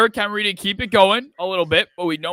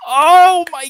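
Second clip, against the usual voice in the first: anguished cry; 8 semitones higher, 13 dB louder than usual. Real, not acted. Realizing parlay chance lost.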